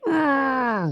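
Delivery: high, strained growl of pent-up anger; playful angry "arr"; mock mad growl. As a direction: growl like a furious little kid. Mimes being really mad.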